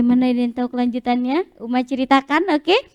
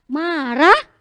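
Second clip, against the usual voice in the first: angry face word. Shown as a demonstration. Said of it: "Tepuk ekspresi".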